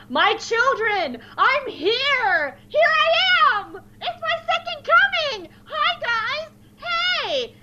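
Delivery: very high, slow, cheerful savior voice; hammed-up Jesus greeting his flock; squeaky high jubilant Jesus; imitating cosplay Jesus. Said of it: "a guy cosplaying as Jesus just runs".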